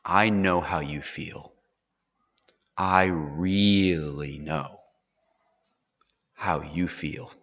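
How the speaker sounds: low, soft, slow reassurance; tender father. Acted. Jacob reassuring Joseph, soft and tender. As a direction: quietly assure him you truly understand. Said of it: "So the special emphasis in this verse is".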